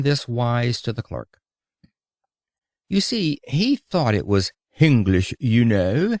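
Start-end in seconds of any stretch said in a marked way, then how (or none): 4.7-6.2 mock English accent. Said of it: "the lisp".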